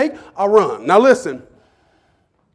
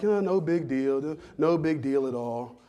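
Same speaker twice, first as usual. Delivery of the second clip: slow, soft and dismissive "no big deal"; mock indifference; 6 semitones lower, 8 dB quieter, slower than usual. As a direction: say "no big deal" like it bores you. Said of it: "we're just like".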